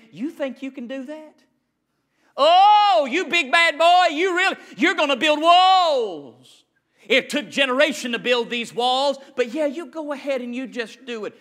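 high, loud mocking sneer; mocking taunt. Enemies mocking Nehemiah as a big bad boy. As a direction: taunt him like a playground bully. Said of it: "imagine them mocking".

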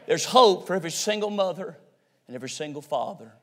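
low, soft voice with pauses; hushed preaching. Real, not acted. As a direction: drop right down after the climax. Hushed turn after shouted climax.

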